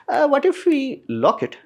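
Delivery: high, slow mocking voice; mock falsetto of a sly product manager; mock company voice. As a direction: squeak it out like a smug executive plotting. Mocking Sony deciding to lock features.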